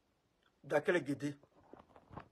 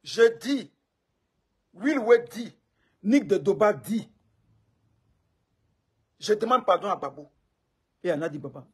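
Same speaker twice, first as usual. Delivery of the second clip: loud declaration; 3 semitones higher, 10 dB louder than usual. Real, not acted. Loudly declaring his apology.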